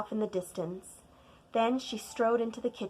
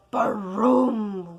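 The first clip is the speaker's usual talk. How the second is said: slow, louder baroom; thunder rumble; 8 dB louder than usual. Acted. Narrator imitating thunder, slow rumble.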